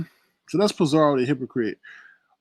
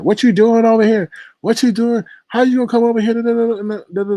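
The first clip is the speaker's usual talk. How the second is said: higher, louder scolding, imitating a heated rant; mock yelling; 9 semitones higher, 5 dB louder than usual. Host yelling at Tanya Iverson, mock angry. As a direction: snap at her like the angry host. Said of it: "yelling and cursing at".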